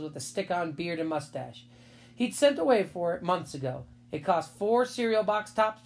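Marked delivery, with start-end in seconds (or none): none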